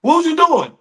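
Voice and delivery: high, loud outraged question; high-pitched mock telling-off; acted scolding. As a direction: yell it like an exasperated coach. Imitated coach scolds failed player.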